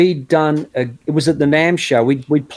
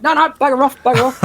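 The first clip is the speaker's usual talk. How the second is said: much higher, squeaky playful bugger off; mock shooing; 10 semitones higher than usual. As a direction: shoo him away in jest. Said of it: "I was like".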